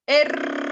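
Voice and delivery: loud "R" held as a very long trill; sustained trilled R. Shown as a demonstration. Teacher models the home exercise, long rolled R. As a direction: keep the "R" rolling on the tongue tip. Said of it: "tiene que estar en la punta de la lengua".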